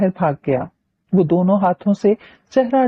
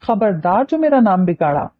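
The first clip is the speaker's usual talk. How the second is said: usual speaking voice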